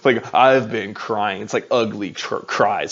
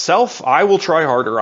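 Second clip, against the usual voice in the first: louder, higher, hammed-up resolve; mock self pep talk; 4 semitones higher, 6 dB louder than usual. Pastor mimicking a churchgoer psyching himself up, mock determined. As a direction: give yourself a pumped-up order. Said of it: "we say to ourselves".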